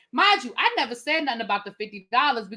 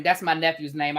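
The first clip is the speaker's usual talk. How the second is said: normal talking voice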